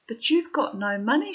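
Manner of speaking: high, loud protest; acted alarm. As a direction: object as a worried daughter would.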